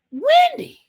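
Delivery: recapping the twist, shocked and high; shocked exclamation. Real, not acted. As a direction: cry the name out in shock. Shocking reveal of a murder.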